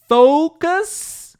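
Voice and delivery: "focus" called out high and loud with a long O; proper pronunciation. Shown as a demonstration. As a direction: model "focus" slowly for learners, FOH-kus. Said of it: "that's the proper pronunciation".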